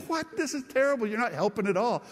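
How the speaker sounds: high-pitched mock outrage; retold indignant disbelief. Pastor replays protesting the psychologist's advice, indignant disbelief. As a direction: act out an incredulous protest.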